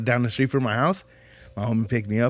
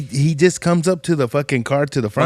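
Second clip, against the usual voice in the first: usual speaking voice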